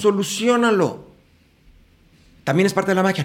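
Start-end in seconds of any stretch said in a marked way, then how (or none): 0.0-1.1 emphatic. Pretend. Urging to fix it.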